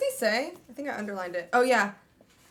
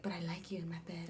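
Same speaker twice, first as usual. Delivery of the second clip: inner thought, soft; 6 semitones lower, 13 dB quieter, faster than usual. Retold or imitated. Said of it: "in his head, he's like".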